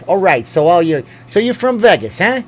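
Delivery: high, loud mock New York tough guy; hammed-up New York tough-guy voice; New York enforcer. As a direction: play a threatening New York official. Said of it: "be like".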